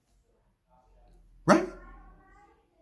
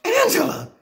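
anguished high cry; 13 semitones higher than usual. Acted. Dramatic call, much higher.

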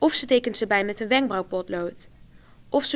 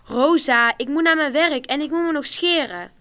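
loud, higher call through the door; impatient calling; 5 semitones higher, 7 dB louder than usual. A narrator's reading. Alexander needing the bathroom before work, loud and impatient. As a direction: push her to hurry up.

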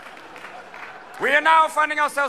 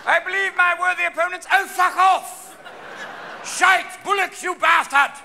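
heckling Parliament shouts; 10 semitones higher, 5 dB louder than usual. Retold or imitated. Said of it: "House of Commons ... Crazy place".

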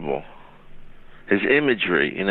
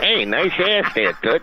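high, slow squeaky puppet voice laughing; a laughing puppet leer; 9 semitones higher, slower than usual. Retold or imitated. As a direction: voice the little puppet looking up.

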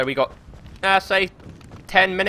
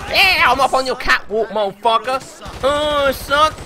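excited taunt; 9 semitones higher, 5 dB louder than usual. Real, not acted. Taunting enemies from the catwalk.

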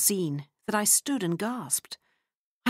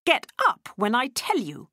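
raised stern command; mildly angry narrated order; angry cry; 6 semitones higher, 4 dB louder than usual. A narrator's reading. Lottie ordering the child up, a touch of fury. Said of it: "she cried".

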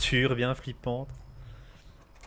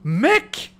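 high, loud cry of joy; excited shout; 14 semitones higher, 6 dB louder than usual. Real, not acted. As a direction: shout it out, thrilled. Thrilled by a big donation.

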